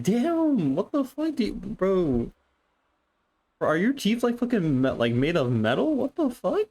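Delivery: freaked-out squealing; shocked disbelief. Real, not acted. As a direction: rant in high-pitched disbelief. Stunned by popsicle biting scene.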